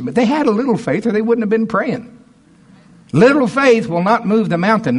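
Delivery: high, loud, fervent preaching; preaching at full force. Acted. Pastor rousing his congregation, in full preaching voice. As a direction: lift the church with it.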